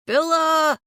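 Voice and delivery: loud high call across the woods. Acted. Searching the woods for the missing aunt, calling out loud. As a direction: call her name into the trees.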